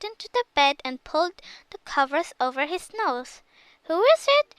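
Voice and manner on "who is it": high, loud, crackly put-on voice; a wolf faking granny's crackle. A narrator's reading. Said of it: "he called in a crackly voice".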